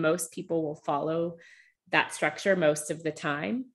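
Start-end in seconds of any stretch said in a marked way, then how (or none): none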